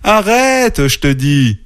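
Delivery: high little-sister yelp. A narrator's reading. Little sister shrieking at her brother on the trunk, yelping. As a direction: squeal the order, pitched well up. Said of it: "glapit encore petite sœur".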